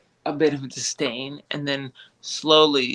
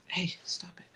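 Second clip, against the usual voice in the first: hushed, unvoiced reprimand; whispered scolding aside; 4 semitones higher, 14 dB quieter than usual. Real, not acted. Host breaks off to stop the dog, whispered scolding. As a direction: drop to a whisper to correct the dog. Aside to Ryder chewing something.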